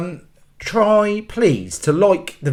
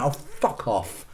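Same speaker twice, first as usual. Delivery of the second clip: annoyed leopard; 6 semitones lower, 9 dB quieter, slower than usual. Retold or imitated.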